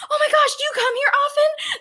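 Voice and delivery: excited fan. Retold or imitated. Baristas recognize her and gush, high and excited.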